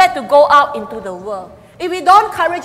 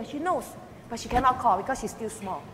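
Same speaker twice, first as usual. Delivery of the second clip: ordinary talk, nothing marked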